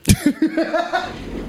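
high cackling clown laugh; evil clown laugh. Real, not acted. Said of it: "that sounded like an evil clown".

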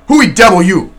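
a menacing question from the dark; cannibal's demand. Acted. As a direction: bark it out like a startled savage. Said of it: "he at last said".

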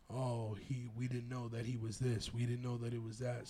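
soft, low mumbled excuse; mock excuse. Mimicking cops excusing not knowing, quiet and low. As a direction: imitate officers excusing themselves under the breath.

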